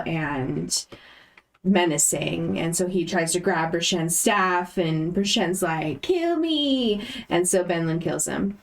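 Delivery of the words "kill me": high, drawn-out plea sliding down; over-eager begging, lightly acted; mock pleading. Brichen begs the armored figure to kill him, mock pleading. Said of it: "the tonality of that was just like".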